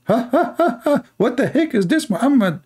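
high mock laughter, then a jeering question; hammed-up jeering laugh. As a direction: cackle like mocking Muslims. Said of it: "they start laughing".

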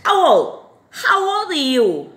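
lively, emphatic classroom question; high teaching demo. Shown as a demonstration. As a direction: lift How old up high and loud.

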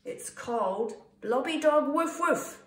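high, loud, proud reveal; joint announcement. A narrator's reading. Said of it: "they both said at the same time".

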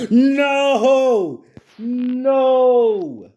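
loud high panicked no; panicked shout. Real, not acted. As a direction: yell in sudden fright. Caught by killer, panic.

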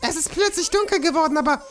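high cute villager voice. Acted. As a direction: chirp it like a tiny cartoon animal.

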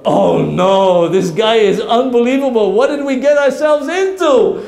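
high, loud dismay; horrified at being found out; alarmed thieves. Acted. Thieves overhear again.

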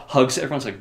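ordinary talk

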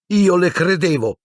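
high, anguished outcry; yelled in rage. A narrator's reading. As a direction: cry out betrayed, pitched high. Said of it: "urlò a Lupin, con la voce che tremava".

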